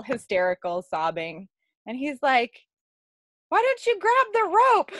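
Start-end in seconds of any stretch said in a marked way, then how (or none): 3.5-4.9 calling out. Retold or imitated. Man calls to his stranded partner, calling out. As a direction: imitate someone shouting over from afar, pitched way up. Voicing Dustin calling to her.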